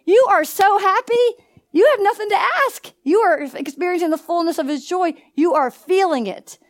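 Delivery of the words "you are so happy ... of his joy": excited. Real, not acted. Preacher lifting congregation.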